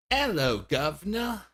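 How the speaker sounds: high, hammed-up British greeting; playful fake British accent; mock British accent. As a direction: say it like a cheeky British toff. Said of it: "I like sounding like I'm British".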